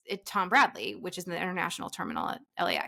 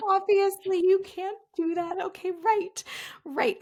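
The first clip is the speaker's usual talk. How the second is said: embarrassed; 10 semitones higher, slower than usual. Retold or imitated. Embarrassed retreat from counter.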